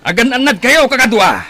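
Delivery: high, loud yell over the fight; battle shout. Acted. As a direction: scream orders across the battlefield. (pukkaw iti tengnga ti gubat)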